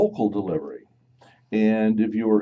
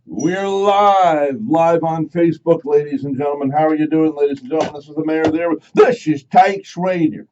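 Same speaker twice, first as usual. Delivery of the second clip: high, loud country announcer; hammed-up cowboy emcee voice; 6 semitones higher, 6 dB louder than usual. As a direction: boom it out like an old western radio host. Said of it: "said that I sounded like [name]".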